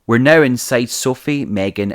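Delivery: usual speaking voice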